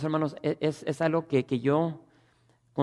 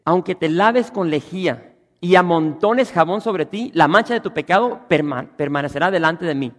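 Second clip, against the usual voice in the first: preaching scripture; 2 semitones higher, 9 dB louder than usual. Acted. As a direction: thunder the verse from the pulpit. Reads Jeremiah at full force.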